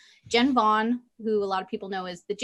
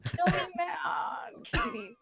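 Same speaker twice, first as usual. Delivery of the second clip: slow, whimpering plea; whiny voice; 8 semitones higher, 6 dB quieter, slower than usual. Acted. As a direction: whine it like a guilty kid. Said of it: "I love a whiny T K voice".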